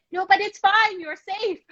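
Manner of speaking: high, sing-song fake reassurance; falsely soothing. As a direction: reassure while clearly panicking. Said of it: "and yet we say".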